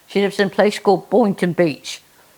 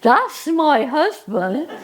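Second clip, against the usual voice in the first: delighted Jenny; 3 semitones higher, slower than usual. Acted. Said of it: "Jenny goes".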